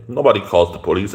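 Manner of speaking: ordinary delivery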